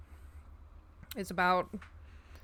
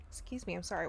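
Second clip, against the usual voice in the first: coughing up water; 8 dB quieter, faster than usual. Real, not acted. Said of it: "water went down the wrong pipe".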